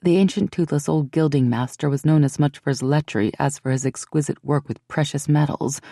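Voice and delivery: ordinary delivery, nothing marked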